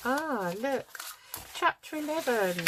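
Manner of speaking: delighted high exclamation; excited. Real, not acted. Delighted find of number.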